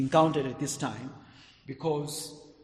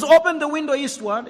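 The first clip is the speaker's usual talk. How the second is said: much louder, higher repeated order; commanding shout; 11 semitones higher, 10 dB louder than usual. Acted. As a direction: call it out like a prophet's order.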